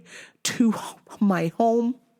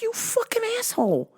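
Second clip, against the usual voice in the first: incredulous cursing; 8 semitones higher than usual. Retold or imitated. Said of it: "I'm like".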